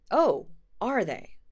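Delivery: loud, slow challenge; defiant retort. Acted. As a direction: throw it back defiantly. Thorin answers defiantly.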